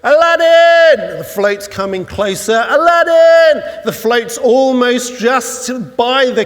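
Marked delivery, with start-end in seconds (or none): 0.0-1.2 high, long-drawn shout for a hero; desperate shout. Acted. Kid shouts to his hero on the passing float, desperate shout. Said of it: "And so he shouts". 2.7-3.8 high, long-drawn shout for a hero; desperate shout. Acted. Kid shouts to his hero on the passing float, desperate shout. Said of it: "And so he shouts".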